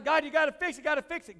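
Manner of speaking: loud pleading cry; desperate prayer. Retold or imitated. Paul crying out to God loudly to fix it. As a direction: shout the demand up to heaven.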